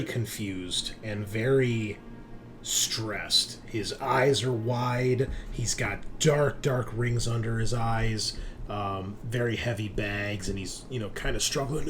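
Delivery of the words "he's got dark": ordinary delivery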